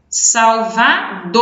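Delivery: Salvador stretched slowly, DOR strongest; slow syllable demo of Salvador, long DOR; stress on last syllable. Shown as a demonstration. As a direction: draw out the final DOR in Salvador. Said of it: "é o mais forte".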